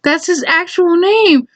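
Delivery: high, drawn-out motherly correction; amused mom. Retold or imitated. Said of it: "my mom started laughing".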